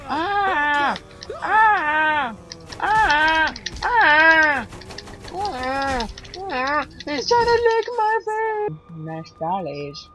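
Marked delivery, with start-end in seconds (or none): 0.1-6.9 high, loud yelps falling off; repeated screams. Pretend. Gamer screaming in mock panic, repeated yelps. 7.0-8.8 very high, loud squeal; mock panic. Gamer attacked in game, high mock panic.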